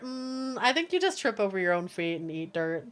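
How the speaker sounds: normal talking voice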